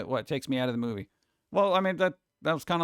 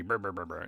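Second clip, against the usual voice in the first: low spooky brr; 10 semitones lower, 8 dB quieter than usual. Pretend. Mocking the candy scare.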